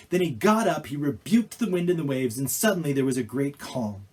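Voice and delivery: ordinary talk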